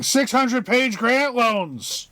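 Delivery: high, drawn-out comic scream; mock scream. Acting out a scientist screaming about grant paperwork, exaggerated scream. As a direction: scream it in exaggerated outrage, stretching the words. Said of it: "where she is screaming".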